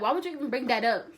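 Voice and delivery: loud, quick retort, imitating an angry grandma; indignant, rapid mock scolding; mad. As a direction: retell her outburst with pushed volume. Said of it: "then she got mad".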